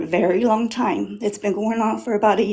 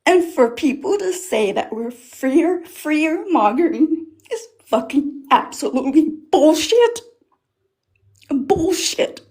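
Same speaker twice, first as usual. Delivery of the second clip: real anger; 5 semitones higher than usual. Real, not acted. Woman at a community meeting rejecting fear-mongering claims, angry. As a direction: pitch climbing higher as anger breaks through. Angry rant about denial.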